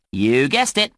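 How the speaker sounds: ordinary delivery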